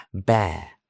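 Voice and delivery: British English accent; British bear. Shown as a demonstration. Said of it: "Os lo pongo en británico".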